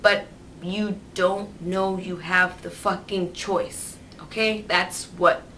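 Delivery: ordinary delivery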